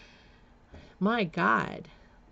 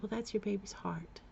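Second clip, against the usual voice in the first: soft whisper; whispered answer; 3 semitones higher, 8 dB quieter than usual. Retold or imitated. Nurse quietly telling her about the ultrasound, in a soft whisper. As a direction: whisper it gently. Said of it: "And she said".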